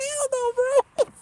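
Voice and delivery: high slow mock cat voice refusing; voicing the cat. Owner voices the cat refusing to come in, high and slow. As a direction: squeak it out as the stubborn cat. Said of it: "He's like".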